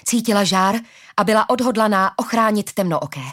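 ordinary delivery

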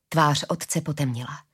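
usual speaking voice

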